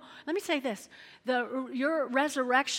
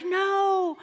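protest; 10 semitones higher, 7 dB louder than usual. Acted. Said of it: "they're like".

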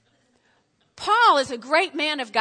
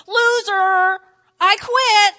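loud, raised, drawn-out self-reproach; mock despairing cry; 7 semitones higher, 11 dB louder, much slower than usual. Preacher acting out comparing himself to another, mock despair. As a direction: throw in the towel loudly. Acts out giving up after comparing.

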